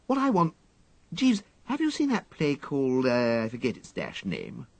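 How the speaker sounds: confiding his loneliness to the valet; lightly plaintive upper-class voice; composed but wistful toff. A narrator's reading. Wooster's voice in story.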